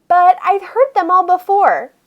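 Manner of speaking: plain reading voice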